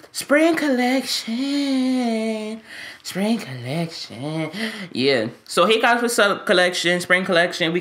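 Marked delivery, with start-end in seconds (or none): 0.1-2.6 stretched very slow and higher, sing-song; drawn-out plug. Pretend. Hawking his spring merch to viewers, slow and higher. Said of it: "drawn-out merch plug". 3.0-4.5 slower, much softer and lower repeat; soft echo. Pretend. Repeating the merch line to viewers, soft and low. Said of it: "echoed merch plug".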